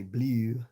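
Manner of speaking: "blue" as "bleeew" with a fronted glide; loud mock-Baltimore "blue"; Baltimore vowel. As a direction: stretch "blue" toward "blee-oo". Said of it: "I don't say".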